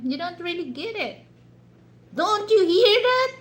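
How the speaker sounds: high, loud, exasperated complaint. Retold or imitated. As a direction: push back hard at someone who isn't listening. Frustrated at husband not understanding.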